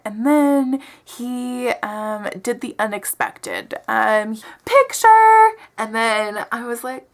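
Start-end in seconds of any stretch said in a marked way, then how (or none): 4.6-5.5 high, loud mock squeal; imitating overeager date. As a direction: squeal it like a giddy photo pest. Imitating date's picture catchphrase.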